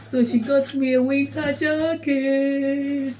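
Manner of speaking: high, slow, singing announcement; gleeful sing-song. Real, not acted. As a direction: sing out the good news, stretching it. Gleefully showing off a new gift.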